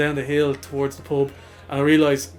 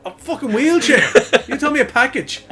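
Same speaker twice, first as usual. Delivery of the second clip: angry dad; 9 semitones higher, 5 dB louder than usual. Retold or imitated.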